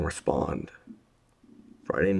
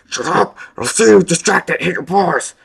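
furious shout; 15 dB louder than usual. A narrator's reading. Urgent command shouted.

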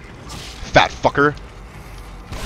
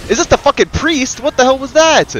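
startled; 10 semitones higher, 3 dB louder than usual. Real, not acted. Surprised by enemy attack.

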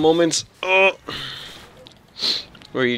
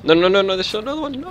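panicked; 8 semitones higher than usual. Real, not acted. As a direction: panic as another enemy appears. Panicked at another enemy.

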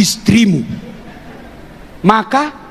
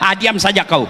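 ordinary talk

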